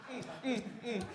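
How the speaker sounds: ordinary talk, nothing marked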